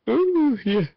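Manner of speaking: stretched-out yawn. Real, not acted. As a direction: yawn while speaking. Yawning mid-sentence.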